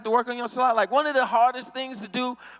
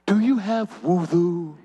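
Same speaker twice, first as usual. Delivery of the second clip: slow, lower tempter's voice; imitated devil; 6 semitones lower, slower than usual. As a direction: plant a doubt, slow and low. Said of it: "Shaitan always whisper in my ear".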